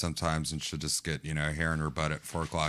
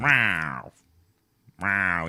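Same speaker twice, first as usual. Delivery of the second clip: a light meow through the door; imitating cat meow; 5 dB louder than usual. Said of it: "And he does the".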